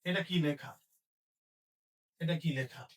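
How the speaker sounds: normal talking voice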